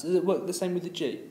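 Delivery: usual speaking voice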